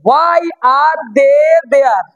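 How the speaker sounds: very slow, word by word; teacher's drawn-out model sentence; slow breakdown. Shown as a demonstration. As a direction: stretch out each word for learners. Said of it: "আগে ছোট করে বুঝে নাও".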